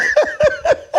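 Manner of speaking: very high burst of laughter; laughing fit. Real, not acted. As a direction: crack up at your own impression. Laughs at own impression.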